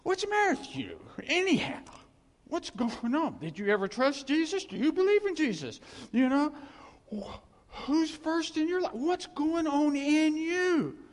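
high, loud scolding of a struggling believer; hammed-up busybody demanding answers; mock scolding preacher. Preacher mocking a nosy church member, loud and high. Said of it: "You'd go".

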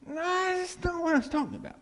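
high mock voice of a puzzled crowd member; hammed-up high confused voice; confused listener. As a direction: squeak it out like someone who missed the point. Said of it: "how many of you have thought".

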